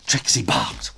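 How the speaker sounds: ordinary delivery, nothing marked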